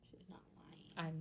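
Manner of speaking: barely audible whisper; whispered aside. Real, not acted. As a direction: say it under your breath, very softly. Quiet aside during teasing.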